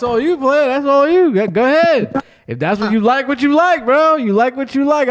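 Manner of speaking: loud, high hype-man cheer; hyped-up encouragement. Acted. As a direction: cheer him on, over the top.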